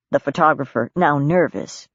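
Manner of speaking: ordinary delivery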